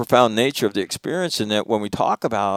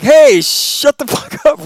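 high, loud, slow mock yell; 13 semitones higher, 7 dB louder, slower than usual. Retelling [name] yelling at the class, high loud mock yell. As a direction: bellow to shut them up. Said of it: "and I'm yelling".

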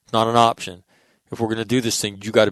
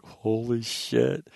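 slow, drawn-out amazement; awed disbelief; 5 dB quieter, much slower than usual. Retold or imitated. Spectator watching the touchdown, slow awed disbelief. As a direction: let it fall out of you in awe. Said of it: "and you're like".

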